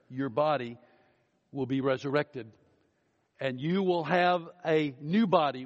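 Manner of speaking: triumphant pulpit build; preaching. Acted. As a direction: lift toward the grave's defeat. Climactic preaching on new body.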